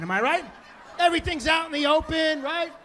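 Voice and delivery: ordinary talk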